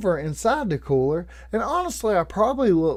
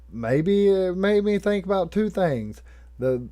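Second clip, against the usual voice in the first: ordinary talk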